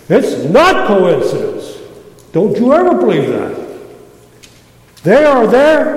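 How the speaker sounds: loud, rising to a high shout on there; fired up preaching. Real, not acted. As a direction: drive it home with fire. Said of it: "I'm fired up right now".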